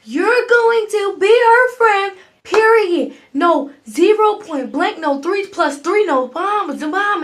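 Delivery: high, loud, emphatic scolding yell; yelling mama. Retold or imitated. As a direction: imitate a mother laying down the law at full volume. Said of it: "I have never heard her yell before".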